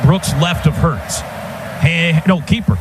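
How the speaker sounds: usual speaking voice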